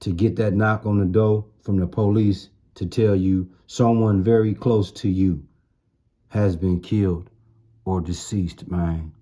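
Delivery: usual speaking voice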